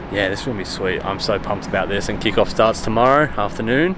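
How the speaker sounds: ordinary delivery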